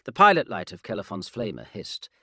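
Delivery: ordinary delivery, nothing marked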